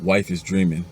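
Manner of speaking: ordinary talk, nothing marked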